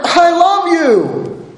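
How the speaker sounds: high, drawn-out declaration of love; God's emphatic declaration, stretched out; God declaring love. Acted. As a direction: proclaim it slowly, pitched well up. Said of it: "as God starts out here".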